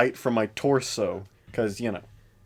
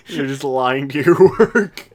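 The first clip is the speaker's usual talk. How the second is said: laughing through the words; 8 dB louder, slower than usual. Real, not acted. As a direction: talk while cracking up. Laughing at friend's excuse.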